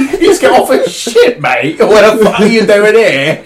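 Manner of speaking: loud, high-pitched British rant with laughs; hammed-up British accent, cracking up. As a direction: rant like an indignant Brit, half laughing. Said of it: "half the British".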